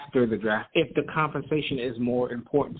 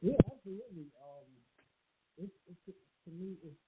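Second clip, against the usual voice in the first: muffled bad headset, much softer; 4 semitones higher, 12 dB quieter than usual. Real, not acted. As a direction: caller through a faulty headset, muffled. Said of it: "You sound muffled".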